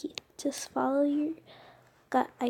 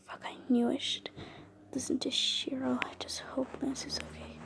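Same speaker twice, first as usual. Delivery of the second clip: worried whisper; 13 dB quieter than usual. Acted. Alone, hiding from enemies.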